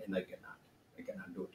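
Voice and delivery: faint whispered admission. Acted. Sage admitting to grasshoppers he cannot, whispered. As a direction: let it die to a whisper.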